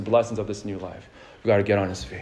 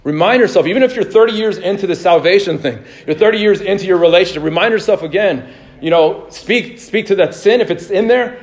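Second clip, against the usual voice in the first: raised, forceful preacher's exhortation; impassioned preaching; 8 semitones higher, 6 dB louder than usual. Real, not acted. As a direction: urge the congregation on, loud and high.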